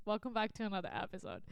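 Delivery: ordinary talk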